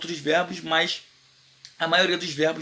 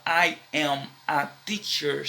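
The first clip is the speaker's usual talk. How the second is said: slow statement model; slower than usual. Shown as a demonstration. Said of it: "Ao invés de você falar".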